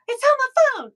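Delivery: a gasp, then blurted out high, loud and fast; sudden realization. Real, not acted. To her chat, suddenly remembering the photo is on her phone, blurted. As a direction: it just clicked where it was.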